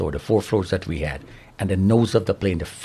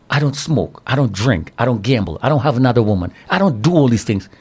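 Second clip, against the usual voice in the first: I don't pushed higher and louder on each line; retold plea, growing louder; insistent protest; 5 semitones higher, 5 dB louder than usual. Pleading with his wife Jen.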